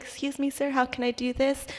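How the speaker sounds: soft, non-confrontational customer service; meekly polite. Retold or imitated. Clerk placating a rude customer, softly polite. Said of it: "I'm just trying to be like".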